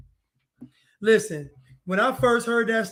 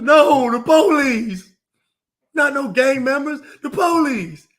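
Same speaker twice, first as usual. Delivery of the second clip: higher, loud, slow mocking insistence; mock insistent shout; 5 semitones higher, 6 dB louder than usual. Mocking protesters who blame the police, hammed-up shout. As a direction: shout back that it's the police.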